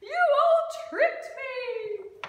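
high, loud, slow wail; outraged howl. A narrator's reading. Dingo accuses the animals, loud high howl. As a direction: wail it out, stretching each word. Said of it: "he howled".

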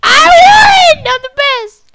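high, loud victory yell; triumphant shout. Real, not acted. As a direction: gloat over the win. Winning a duel, triumphant shout.

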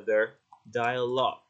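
slow, clear "the dialogue", each part given full weight; slow, careful. Shown as a demonstration. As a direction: announce the next section clearly. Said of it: "Mình sẽ đọc là". (đọc chậm, rõ ràng)

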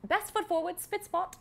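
high, brisk British nanny voice; Mary Poppins accent. Pretend. Actress showing the host her Mary Poppins voice in British. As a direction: clip it like a prim British nanny. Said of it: "Is your accent any good?".

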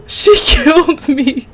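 high, loud, drawn-out cry; slow squealing lament; dismayed wail. Real, not acted. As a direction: wail it in shock at being caught. Killed in the game.

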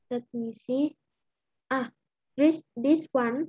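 normal talking voice

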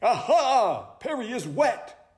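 high, lively sleuth voice; excited high-pitched owl sleuth; eager owl detective. Acted. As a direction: announce it like a keen detective.

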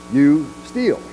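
normal talking voice

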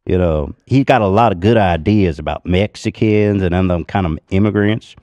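put-on loud, drawled good-old-boy southern accent. Shown as a demonstration. Comic showing off a MAGA good old boy, southern accent. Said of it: "But my southern accent".

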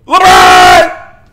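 high, loud yelled name; dramatic yell. Real, not acted. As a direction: belt out the name in disbelief. Dramatic reaction to news.

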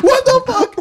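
high laughing disbelief; high incredulous squeal; shocked. Retold or imitated. Shocked by the cop.

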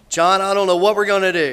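loud, high impression of an upset mother; acted distress at high volume; Miss Sherry. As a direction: wail it out loudly, imitating her. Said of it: "Casey's mom said it best".

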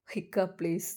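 softly begging through tears; tearful plea. Acted. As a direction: plead in a small broken voice. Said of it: "തേങ്ങിക്കരഞ്ഞുകൊണ്ട്".

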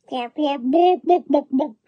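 very high hen clucks; clucking hen. A narrator's reading.

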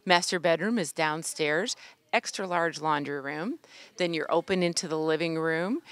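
ordinary talk, nothing marked

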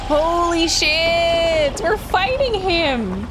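high, drawn-out shocked yell; excited shouting as a fight starts; shocked excitement. Real, not acted. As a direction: blurt it out, stunned and thrilled. Sudden boss fight begins.

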